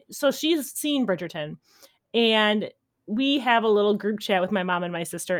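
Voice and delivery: ordinary talk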